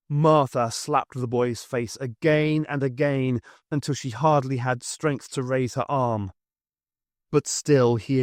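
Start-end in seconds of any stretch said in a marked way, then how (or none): none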